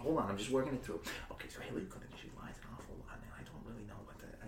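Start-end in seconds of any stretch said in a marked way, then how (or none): 1.3-4.3 quick soft whisper to oneself; muttered whisper calculations. Acted. Working out who should be president, a fast whisper. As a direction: whisper your sums under your breath.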